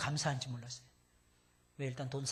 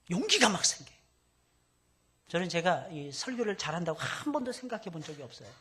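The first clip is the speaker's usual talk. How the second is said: normal talking voice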